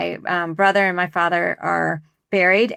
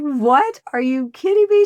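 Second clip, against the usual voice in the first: imitating her, much higher and disbelieving; retold shocked disbelief; 6 semitones higher, 3 dB louder than usual. Retelling how the wife reacted to the wrong cemetery, mock disbelief. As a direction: replay her stunned "are you kidding me".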